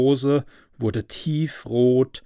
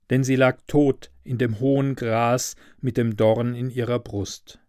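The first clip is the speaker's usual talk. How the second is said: plain reading voice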